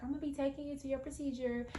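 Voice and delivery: high, soft, quick nurse voice. Retold or imitated. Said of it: "the nurse comes in and she was like".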